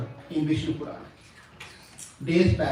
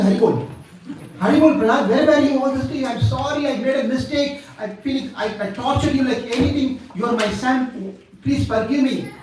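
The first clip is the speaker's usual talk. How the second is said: high-pitched, gushing father; effusive acted apology; 7 semitones higher than usual. Overjoyed father begging his son's forgiveness, effusive. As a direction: pour out apologies to a son just regained.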